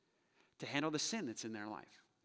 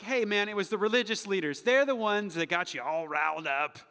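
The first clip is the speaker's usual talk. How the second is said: high-energy, forceful acted blame-shifting; loud mock excuse; 4 semitones higher, 10 dB louder than usual. Preacher mimics excusing the crowd, loud mock blame. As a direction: shove the blame loudly onto someone else.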